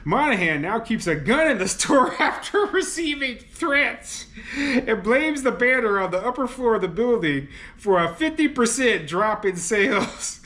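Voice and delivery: laughing while reading. Real, not acted. Host reading the article to viewers, laughing. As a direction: read it out, losing it to real laughter. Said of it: "I'm, I'm, I'm laughing".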